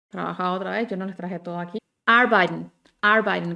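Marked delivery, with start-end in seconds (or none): 2.1-3.6 arbeiten said fast as "arban", R and E nearly gone; swallowed R and E. Shown as a demonstration. Teacher shows how natives shorten arbeiten, loud. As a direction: clip arbeiten down to two quick syllables. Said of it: "Casi no suena la E".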